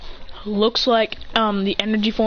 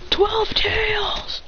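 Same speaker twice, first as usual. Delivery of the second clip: high, drawn-out summoning shout; summoning call; 12 semitones higher, much slower than usual. Retold or imitated. A ninja calling up his twelve-tailed beast, high.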